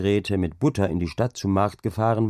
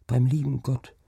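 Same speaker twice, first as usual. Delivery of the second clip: put-on higher, wondering child voice; child repeating; 5 semitones higher than usual. Child echoes father's words, wondering. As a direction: echo the words like a puzzled child. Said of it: "wiederholte das Kind".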